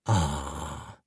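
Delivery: low, contented groan of pleasure; satisfied groan. A narrator's reading. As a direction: sink into a lazy satisfied groan. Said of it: "with a satisfied groan".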